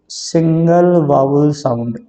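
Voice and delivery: key term said at half speed, syllables drawn out; slow emphatic repetition. Shown as a demonstration. Teacher repeats the definition of a syllable for students, slow and deliberate. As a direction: stretch each word out for learners.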